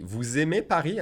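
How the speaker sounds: "Vous aimez" linked with a z sound; liaison. Shown as a demonstration. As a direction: say "Vous aimez" as "vou-zaimez". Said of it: "I'm making the liaison".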